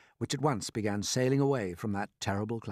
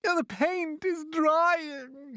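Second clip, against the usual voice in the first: high, slow, drawn-out moan; loud moaning; much slower than usual. A narrator's reading. Centipede feels the paint drying on him, moaning loudly. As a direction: moan it long and high in distress. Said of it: "he moaned".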